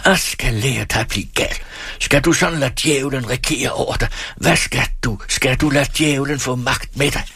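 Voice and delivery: high, hammering angry tirade; furious father's scolding; shouted rage. A narrator's reading. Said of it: "råbte den gamle".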